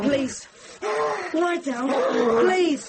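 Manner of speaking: high, urgent begging; pleading. Acted. Boy begs the raving monk to lie down, urgent and high. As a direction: beg him to lie back down.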